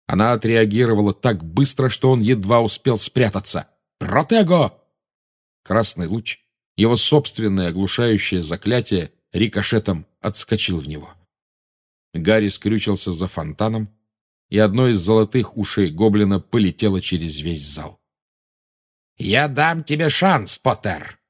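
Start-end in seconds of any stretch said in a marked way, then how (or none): 4.0-4.8 higher, urgent spell cry; shouted spell. A narrator's reading. Wizard casts a shield spell in a duel, crying out. 19.2-21.2 slow, raised taunting call; Belatrisa calling out. A narrator's reading. Witch offers her enemy a chance, calling out slowly. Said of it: "крикнула Белатриса".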